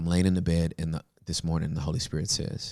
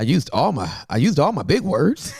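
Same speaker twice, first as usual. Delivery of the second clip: high, loud, laughing through it; amused, high and loud; 9 semitones higher, 7 dB louder than usual. Real, not acted. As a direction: laugh as you admit it. Laughing at own story.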